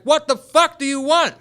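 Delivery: very high, loud angry yell; pissed-off shout. Retold or imitated. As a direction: demand it in a furious shout. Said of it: "I'm, I'm pissed".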